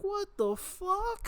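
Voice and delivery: very high, slow drawn-out "What the fuck"; long high incredulous wail; stunned disbelief. Retold or imitated. Reacting to teacher news.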